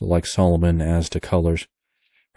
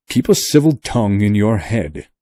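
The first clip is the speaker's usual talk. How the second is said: put-on angry young man; 3 semitones higher, 6 dB louder than usual. A narrator's reading. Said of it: "cried the young man".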